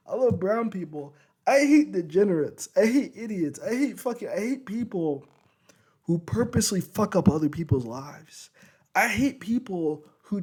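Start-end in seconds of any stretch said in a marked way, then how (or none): none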